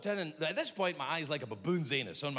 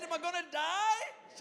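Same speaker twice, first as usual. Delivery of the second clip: whiny scared; 14 semitones higher, slower than usual. Retold or imitated. Scared patient asks doctor.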